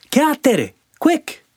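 very high, urgent tiny voice; squeaky sandfly cry. Acted. Namu urging Sandy on, very high squeaky cry. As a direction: hurry them on like a buzzing sandfly. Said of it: "cried Sandy".

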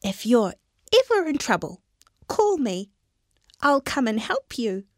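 high, fast squeaky promise; little mouse offering help to the lion; eager mouse. Acted. Mouse character voice.

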